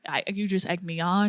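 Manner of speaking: soft, earnest retold apology; imitated genuine apology. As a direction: imitate a friend apologizing quietly and sincerely. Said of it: "he was, like, genuine".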